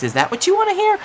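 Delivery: sudden high, strained question; exasperated outburst. Acted. Brother losing patience with silent Travis, pitch leaping up. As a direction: throw it back at him in disbelief.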